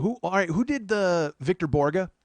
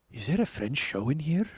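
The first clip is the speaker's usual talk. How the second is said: put-on lightly done French accent; a dubious mock French accent. Host playing French guy at the door, French accent. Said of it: "You little French guy".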